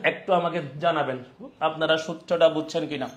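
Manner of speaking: ordinary talk, nothing marked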